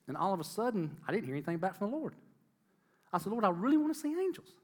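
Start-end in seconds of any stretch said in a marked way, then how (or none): 3.3-4.5 pleading. Retold or imitated. Pleading prayer retold, pitch jump.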